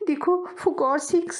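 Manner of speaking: high, slow voiced plea; slow high spoken plea; begging aloud. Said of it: "मिन्नत समाजत करते हुए".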